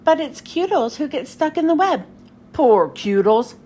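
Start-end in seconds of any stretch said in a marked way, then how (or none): 2.5-3.6 low, slow, louder villain pity; a gruff pirate feeling sorry; Pete voice. Acted. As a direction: Pete seeing Cutles stuck in the web. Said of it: "says Pete".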